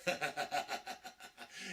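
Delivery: evil laugh. Acted. Villain shows off evil laugh.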